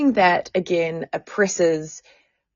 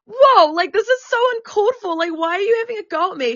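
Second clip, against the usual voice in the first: much higher, hurried, wounded objection; upset retold protest; 12 semitones higher than usual. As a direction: reenact a hurt plea against unfair scolding. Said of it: "I start crying".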